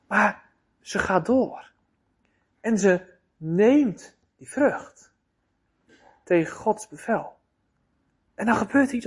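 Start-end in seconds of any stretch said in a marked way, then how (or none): none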